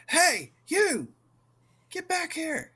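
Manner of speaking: high-pitched acted shout after fleeing people; calling down a hallway. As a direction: holler it high, as a man calling them back. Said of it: "his voice is echoing down the hallway".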